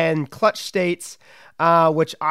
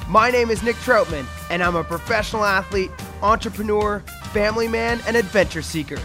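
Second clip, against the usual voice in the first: podcast announcer read; 3 semitones higher than usual. Acted. Scripted show intro over music.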